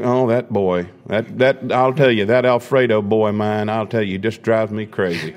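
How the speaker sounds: folksy Southern drawl for an exasperated father; Southern accent. Acted. Father griping to listeners about his boy, Southern accent. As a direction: put on a comic Southern accent. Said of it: "he had a Southern accent at that moment".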